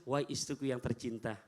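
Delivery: soft, low and loving; tender call. Retold or imitated. Prophet gently calling his wife, soft and loving. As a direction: gently address a beloved wife. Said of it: "Rasul panggil".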